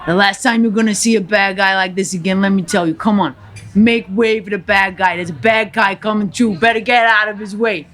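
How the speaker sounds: put-on lightly Cuban-accented gangster rant; defiant Scarface tirade, a hint of accent; Tony Montana. Tony Montana sneering at the restaurant crowd, light Cuban accent. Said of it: "to Tony Montana".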